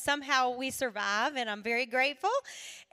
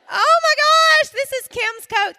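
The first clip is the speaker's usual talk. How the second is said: much higher and louder, slowed delight; delighted gasp; 13 semitones higher, 15 dB louder than usual. Real, not acted. Speaker thrilled to be wearing her friend's coat, high and loud. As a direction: gush over the coat.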